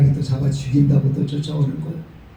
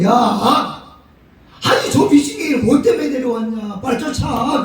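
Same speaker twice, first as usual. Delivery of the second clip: king shouting orders; 6 semitones higher than usual. Acted. King of Gath shouts about madman.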